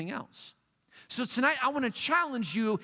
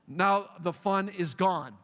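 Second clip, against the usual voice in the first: usual speaking voice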